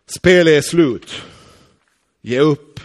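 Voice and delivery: loud, taunting call of defeat; taunting enemy. Acted. Preacher voicing the enemy, loud taunting. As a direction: gloat over the beaten man.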